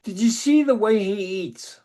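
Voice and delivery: lightly voiced wife, pointed question; knowing wife voice. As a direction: ask it like she's sure. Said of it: "she says".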